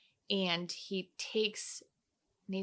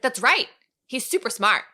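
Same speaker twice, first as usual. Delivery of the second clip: loud, high excited quote; proud exclamation; 5 semitones higher, 10 dB louder than usual. Retold or imitated. Retelling Katie's answer about Mickey, proud exclamation. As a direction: burst out with delighted pride, loudly.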